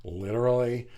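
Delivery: lightly imitated sitcom pedant, savouring each word; Sheldon. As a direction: repeat it mockingly, breaking into laughter at the end. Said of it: "And Sheldon's like".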